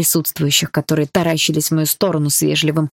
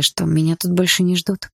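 ordinary delivery

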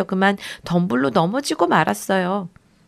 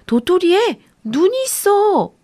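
high, slow amazed call; shouting; 8 semitones higher, 4 dB louder than usual. Acted. Boy shouting to his sister that the acorn has eyes. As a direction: call out in astonishment. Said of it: "빌리가 외쳤어요".

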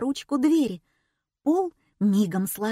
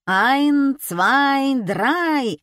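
loud, higher magician's countdown; yelling; 6 semitones higher, 6 dB louder, much slower than usual. A narrator's reading. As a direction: bellow the count to the arena. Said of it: "орал Жиль".

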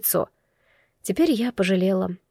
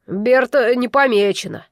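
ordinary delivery

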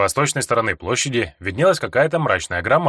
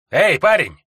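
sharp, higher, louder call; 6 semitones higher, 5 dB louder, slower than usual. Acted. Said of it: "Резкий голос".

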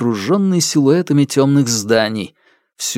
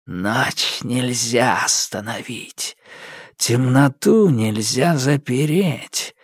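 ominous darkness voice; 3 dB quieter, slower than usual. Acted. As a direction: intone it like a dark spirit. Darkness speaks as a figure.

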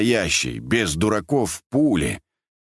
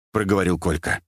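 plain reading voice

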